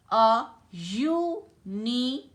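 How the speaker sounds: अ यूनिवर्सिटी read slowly, यूनिवर्सिटी opening with a य sound; slow demo, यूनिवर्सिटी starting on य; slow example reading. Shown as a demonstration. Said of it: "जैसे देखो".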